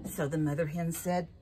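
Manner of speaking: usual speaking voice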